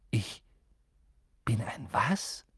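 slow, hushed, halting question; small husky bewildered voice; stunned. A narrator's reading. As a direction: breathe it out in disbelief. Harry stunned by the news.

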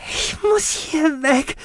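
very high, slow, strained plea; frail but insistent; emphatic. Acted. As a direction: press each word out desperately. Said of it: "sagte sie nachdrücklich".

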